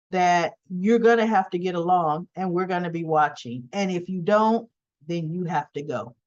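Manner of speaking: ordinary talk, nothing marked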